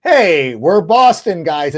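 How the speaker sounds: loud, slow mock Boston tough-guy voice; a light Boston accent, hammed up and drawn out. Mocking Affleck and Damon's movie roles in a Boston accent. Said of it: "They're always like".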